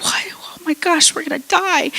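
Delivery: high-pitched freaking-out imitation; hammed-up fright; mock panic. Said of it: "the disciples start freaking out".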